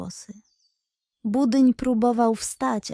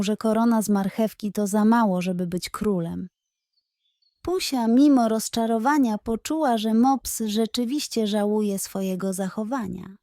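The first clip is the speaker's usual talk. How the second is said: ordinary delivery, nothing marked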